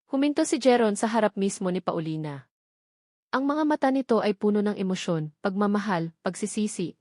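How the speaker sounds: plain reading voice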